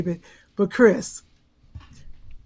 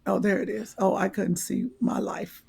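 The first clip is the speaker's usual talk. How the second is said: whispered aside; 3 semitones lower, 6 dB quieter than usual. Real, not acted. Muttering at her screen.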